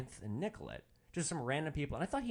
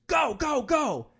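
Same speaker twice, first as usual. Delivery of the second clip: screaming high and loud; panicked shout to the driver to floor it; retold scream; 12 dB louder, slower than usual. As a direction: yell it like you're fleeing a fight. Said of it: "and he screams".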